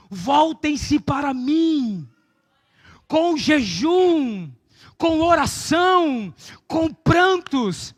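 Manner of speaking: very slow, drawn-out call; slow, solemn preaching. Acted. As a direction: stretch each phrase like an appeal. Preaching God's call slowly.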